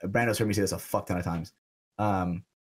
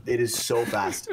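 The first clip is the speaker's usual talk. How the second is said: ordinary talk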